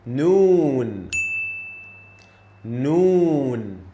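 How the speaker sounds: 'Noon' long, loud, falling; Arabic letter Noon. Shown as a demonstration. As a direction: stretch 'Noon' out.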